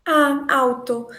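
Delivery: ordinary delivery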